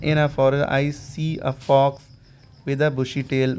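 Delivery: slow, careful English example; slow example reading. Shown as a demonstration. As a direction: read slowly for students. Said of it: "example sentence read out".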